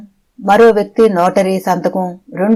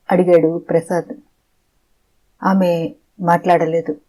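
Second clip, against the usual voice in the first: usual speaking voice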